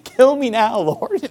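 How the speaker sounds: jokingly voiced exasperated mother; fed-up mom. Mom prays when children complain about food, mock despair. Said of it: "your mom is like".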